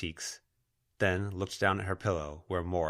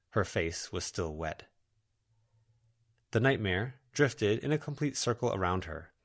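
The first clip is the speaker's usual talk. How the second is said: usual speaking voice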